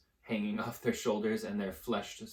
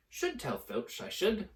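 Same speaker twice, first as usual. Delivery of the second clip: saintly voice; 9 semitones higher than usual. Acted. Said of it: "in a saintly voice".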